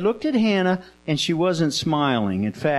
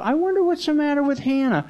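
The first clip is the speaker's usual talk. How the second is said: puzzled wondering; 10 semitones higher, faster than usual. Acted. Eli wonders aloud, much higher.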